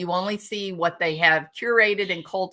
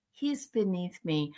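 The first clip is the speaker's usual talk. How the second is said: quieter, lingering haughty sneer; snooty mock voice, softer still; 2 semitones lower, 9 dB quieter than usual. Streamer repeats rival's snobbery, softer and haughtier. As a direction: let the snob's line fade to a sniff. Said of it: "be like".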